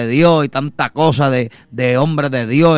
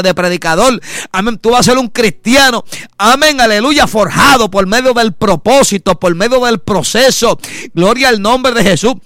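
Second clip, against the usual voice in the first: higher, louder preaching at full force; fervent preaching; 6 semitones higher, 3 dB louder than usual. Acted. Brother exhorting the congregation through trials, loud fervent preaching. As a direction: build to a fervent preacher's cry.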